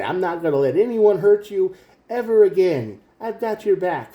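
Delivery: a mildly hammed-up heartfelt pledge; protective promise.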